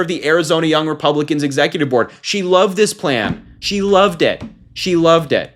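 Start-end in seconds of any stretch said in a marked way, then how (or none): 2.2-5.5 sarcastic emphasis. Real, not acted. Sarcastic repetition after call-up.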